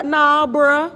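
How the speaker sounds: firmer dismissal. Retold or imitated. Insisting to her uncle it is still sugar, pointed dismissal. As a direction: lean in and refuse to buy it. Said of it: "but I".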